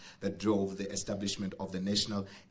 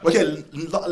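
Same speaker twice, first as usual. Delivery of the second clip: laughing; 6 semitones higher, 8 dB louder than usual. Real, not acted. MC laughing into next item.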